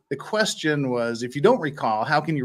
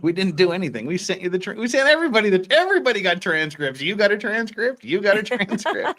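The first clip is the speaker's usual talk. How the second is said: high, louder nagging imitation; mocking whine; 8 semitones higher, 3 dB louder than usual. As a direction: mimic the lawyer's defensive whine. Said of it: "mocking the opposing lawyer".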